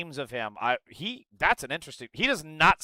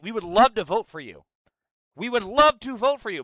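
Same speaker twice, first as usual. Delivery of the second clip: imitating an insistent, heartfelt plea; emphatic retold appeal; earnest pleading; 6 semitones higher, 5 dB louder than usual. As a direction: plead with raised voice, leaning on love. Voicing Palestinians pleading with Democrats.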